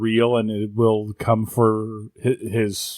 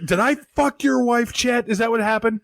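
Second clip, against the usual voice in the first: ranting anchor; 9 semitones higher than usual. Retold or imitated.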